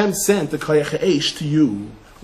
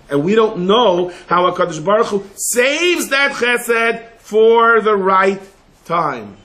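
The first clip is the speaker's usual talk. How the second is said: slow emphatic preaching; 5 semitones higher, 4 dB louder, slower than usual. Real, not acted. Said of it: "preaching emphasis, slow".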